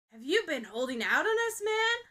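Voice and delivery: loud teasing, shooting up high on man; loud playful jab at his friend; provoking tease. A narrator's reading. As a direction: needle him with a grin. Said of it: "fearless as he's ever been in provoking".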